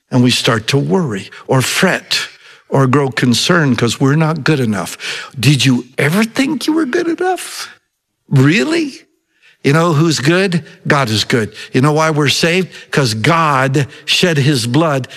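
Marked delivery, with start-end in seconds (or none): 5.4-9.0 high, doubting question thrown at the listeners; incredulous challenge. Real, not acted. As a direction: challenge them, half teasing. Preacher challenges congregation.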